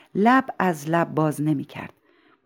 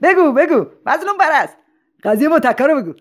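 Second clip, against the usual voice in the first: very high, loud chanting demands; crowd clamouring; 11 semitones higher, 5 dB louder, faster than usual. Acted. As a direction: egg him on like a rowdy audience. (همهمهٔ پرشور جمعیت با صدای بلند)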